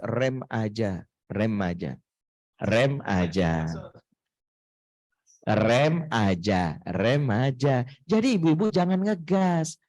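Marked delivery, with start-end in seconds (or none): 0.0-7.9 slow, lower "rem aja" with weight on rem; drawn-out "rem aja" wordplay. Shown as a demonstration. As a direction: spell out "rem aja" slowly, leaning on rem. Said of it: "ini masa ortu dilarang ngegas".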